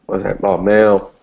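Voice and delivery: normal talking voice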